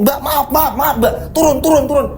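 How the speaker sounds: high, hurried pleading to get off; urgent apologetic dismissal. Acted. Driver forced to put the woman off, urgent apologetic dismissal. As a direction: apologize while shooing her away. Terpaksa mengusir perempuan tersebut.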